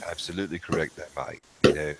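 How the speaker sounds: low, soft English-accented impression; Chris Collier. Shown as a demonstration. Man answering his friend in character, low soft English accent. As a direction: drop into a quiet, measured English gentleman. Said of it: "That right there would be Chris, Chris Collier".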